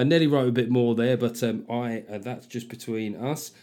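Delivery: ordinary talk, nothing marked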